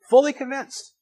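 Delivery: Fully convinced cried out high and loud; emphatic echo. Real, not acted. Emphatic echo, much higher.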